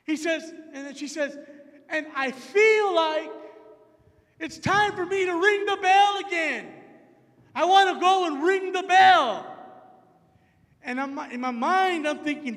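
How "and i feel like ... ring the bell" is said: bold proclamation. Retold or imitated. Retold proclamation of the stranger.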